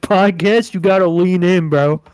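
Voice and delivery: loud, pushy podcast-host spiel; hammed-up booming host voice; mock host command. Mock podcast host demanding mic closeness.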